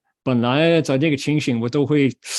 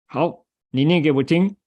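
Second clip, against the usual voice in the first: usual speaking voice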